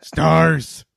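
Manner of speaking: Nemesis impression. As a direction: snarl it like a monster. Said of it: "That's better".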